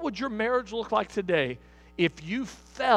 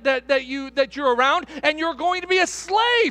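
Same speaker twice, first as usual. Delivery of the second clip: raised, driving sermon voice building to a shout; full-force preaching; 5 semitones higher, 4 dB louder than usual. Real, not acted. Preacher telling the church the slave belongs to the master, impassioned. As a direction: hammer it home, higher and louder towards the end.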